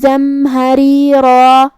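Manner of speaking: slow, melodic tilawat; Quran recitation. Acted. Teacher reciting the verses for students, melodic and slow. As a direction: chant the verses slowly with long vowels.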